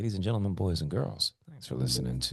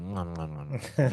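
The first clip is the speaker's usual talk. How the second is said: whispered air kisses; 5 semitones higher than usual. Retold or imitated. Host mocks his friend loving the spotlight, whispered air kisses. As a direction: blow little whispered kisses to the crowd. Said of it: "And Chase just enjoys the spotlight, going".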